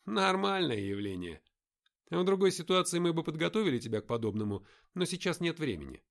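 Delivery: lightly voiced brisk guide; a hint of a commanding leader; character voice. Nick voiced higher.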